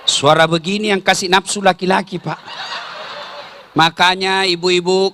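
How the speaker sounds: ordinary delivery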